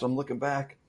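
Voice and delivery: ordinary delivery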